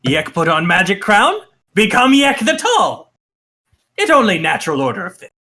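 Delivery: proud goblin boss in broken speech; self-important goblin declaring his title; Yek. Acted. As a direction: boast like a puffed-up goblin king, jumping up in pitch midway. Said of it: "and he goes".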